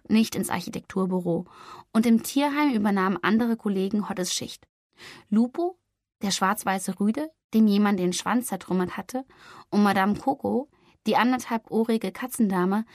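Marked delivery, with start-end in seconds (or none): none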